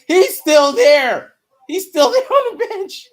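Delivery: high, loud laughing slow exclaim; real laughing shout; laughing disbelief. Real, not acted. Laughing at player still on bench.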